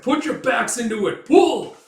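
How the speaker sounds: loud, high bellow rising on pull; captain yelling at rowers; rowing shout. Acted. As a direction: drive the oarsmen hard. Urging rowers.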